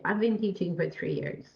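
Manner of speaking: low, "three years" given no lift; robotic, no stress. Shown as a demonstration. Teacher demonstrating an unclear answer, robotic and unstressed. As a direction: drop "three years" in with no emphasis. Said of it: "That's not very understandable".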